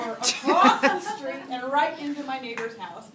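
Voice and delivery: usual speaking voice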